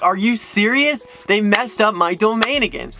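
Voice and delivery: high-pitched, drawn-out disbelief; incredulous customer complaint, each word stretched; exasperated outburst. Retold or imitated. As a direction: spell it out slowly in high exasperation. Acted exasperated outburst at phone agent.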